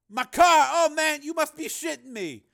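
high, loud disbelief; incredulous protest. Acted. Pimp learning his car is being taken, incredulous. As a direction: wail in outraged disbelief, pitched far up.

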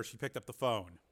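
ordinary delivery

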